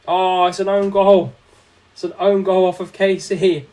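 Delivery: loud, drawn-out groan at the own goal; dismayed own goal call. Real, not acted. Streamer loudly reacting to an own goal, dismayed. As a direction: react loudly and slowly to conceding.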